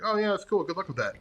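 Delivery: lightly imitated lack of interest; polite brush-off. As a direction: imitate someone airily dismissing it. Retold dismissive brush-off.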